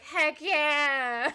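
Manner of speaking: loud, drawn-out celebratory cheer; triumphant. Retold or imitated. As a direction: re-enact a gleeful little victory shout. Quotes own past triumph.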